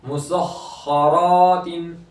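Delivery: मुसख्खरातिन with heavy ख and long रा; stretched full रा. Shown as a demonstration. Teacher shows how to read it, मुसख्खरातिन with रा held long. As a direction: hold the doubled ख, then draw out रा in मुसख्खरातिन. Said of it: "एक अलिफ के बराबर खींचेंगे".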